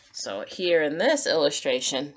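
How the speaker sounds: ordinary delivery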